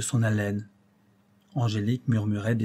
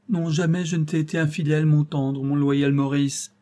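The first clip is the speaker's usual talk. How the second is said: pleading lover; 5 semitones higher, 4 dB louder than usual. A narrator's reading. Angélique pleads her faithfulness.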